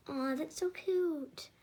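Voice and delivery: ordinary delivery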